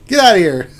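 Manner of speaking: loud laugh-through get out; amused mock dismissal; laughing shout. Real, not acted. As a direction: shoo them away while cracking up.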